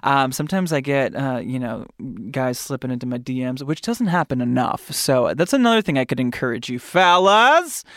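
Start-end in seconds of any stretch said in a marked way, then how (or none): none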